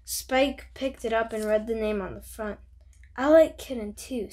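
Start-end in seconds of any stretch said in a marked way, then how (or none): 3.2-4.3 louder, emphatic declaration; announced. A narrator's reading. Spike declaring himself to Splat, announced firmly. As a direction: announce it to a rival. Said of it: "Spike announced".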